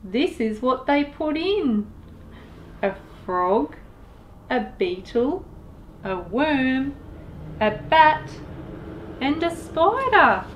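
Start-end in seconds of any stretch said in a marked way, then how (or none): none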